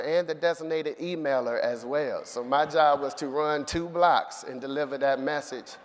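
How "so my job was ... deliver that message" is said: normal talking voice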